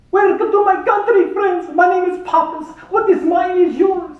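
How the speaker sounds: high, loud hammed-up Greek accent; a terrible Greek tour guide impression, loud and high. Greek guide greeting tourists off the plane, hammed-up Greek accent. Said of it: "terrible Greek impersonation".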